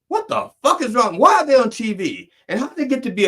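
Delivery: high, indignant rant; outraged rant. Real, not acted. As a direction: get worked up and incredulous.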